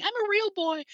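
very high-pitched little boy impression; put-on high childlike voice; mock real boy. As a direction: squeak it out as a wide-eyed puppet. Quotes a puppet-like role.

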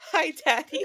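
words squeezed through laughter; laughing to tears. Real, not acted. Laughing-crying at the quote.

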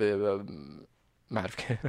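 ordinary talk, nothing marked

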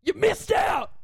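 mock-triumphant shout at her exes; gloating shout. Host shouting at her exes on air, gloating and loud. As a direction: yell it out, rubbing it in.

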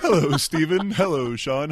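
usual speaking voice

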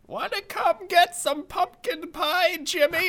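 very high, loud, slow grandma. Acted. Grandma offering Jimmy pie, high grandma voice. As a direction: call out as a hammed-up sweet old granny. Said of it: "Grandma's like".

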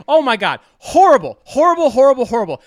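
loud, high cry of disgust; real loud rant at the show; disgusted outburst. Real, not acted. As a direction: blurt out horrible, horrible, real and loud. Ranting about a bad show.

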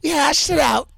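Teresa voice. Retold or imitated. Retelling Teresa's reconciliation news, high put-on voice. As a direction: chirp it up high like her. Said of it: "She goes".

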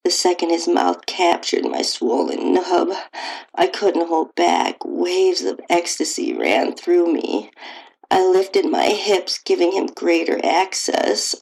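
ordinary delivery

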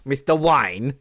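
lightly played Cockney impression; Michael Caine. Felix Leiter questioned as Mister Wayne's butler would, Michael Caine impression. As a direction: put on a Cockney geezer accent. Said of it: "like Michael Caine".

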